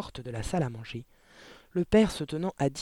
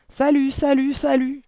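high parrot call ringing out; loud, clear voice; 11 semitones higher, 9 dB louder than usual. A narrator's reading. Hidden parrot startling the family at dinner, loud clear squawk. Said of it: "une voix forte et claire retentit".

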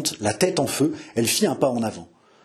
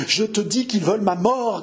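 high, frantic paranoid outburst; agitated grating; 8 semitones higher than usual. A narrator's reading. King to his mistress about plotters, grating agitation. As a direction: insist in rising panic. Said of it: "grinça-t-il".

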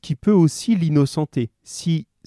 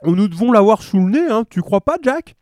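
loud, high, rising question; thick-voiced policeman; 7 semitones higher, 5 dB louder than usual. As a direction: ask a mate loudly through food. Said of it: "de leurs grosses voix pâteuses".

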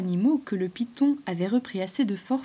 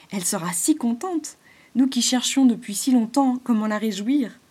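eager squirrel; 3 semitones higher, 8 dB louder than usual. Acted. Squirrel Mano proposes sowing moonwort for the moon, eager. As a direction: urge the plan with delight.

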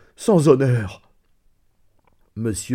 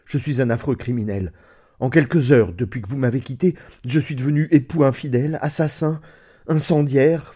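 voix qui monte, affolée, plus forte; aveu désespéré; 4 semitones higher than usual. Acted. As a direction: confesse tes crimes dans la panique. Narrateur avoue ses crimes, désespéré. (aveu paniqué et suppliant)

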